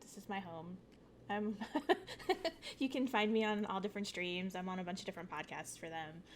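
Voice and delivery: ordinary talk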